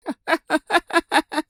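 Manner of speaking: high, staccato villainess laugh; mad cackle. Acted. Crazed attacker laughing at her bleeding victim, high mad cackle.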